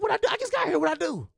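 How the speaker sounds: squeaky high what did I do; sudden high-pitched panic at the summons; retelling being called to the office in a high panicked voice; alarmed high protest. Retold or imitated. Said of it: "I said".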